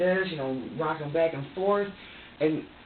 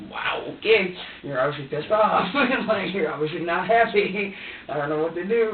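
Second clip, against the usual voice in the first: flustered exclamation; 6 semitones higher, 7 dB louder than usual. Retold or imitated. Reacting to baby's angry yell.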